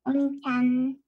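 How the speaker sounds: voz de niña anime, aguda y tierna; voz de loli. Acted. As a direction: dilo como una chica de anime. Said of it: "yo puedo hacer voz de Loli". (voz de loli anime)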